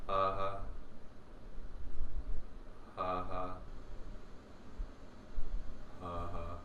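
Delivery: low double "ha" on the out-breath; breath turned to sound. Shown as a demonstration. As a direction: let the breath drop in, then release it low. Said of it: "to turn to sound".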